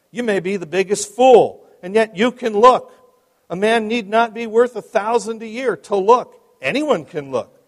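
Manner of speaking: loud, lifted homespun pulpit exhortation; earnest lay preacher hammering a simple text. Acted. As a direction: preach it emphatically to a small chapel. Voices the lay preacher's sermon.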